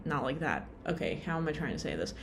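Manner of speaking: normal talking voice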